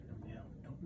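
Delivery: whisper. Real, not acted. Hushed aside while reading.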